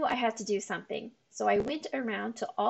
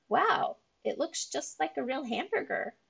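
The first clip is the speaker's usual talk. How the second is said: bright, higher, amazed wow; impressed; 4 semitones higher than usual. A narrator's reading. Girl admiring the cracker patties, amazed wow. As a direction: sound truly impressed.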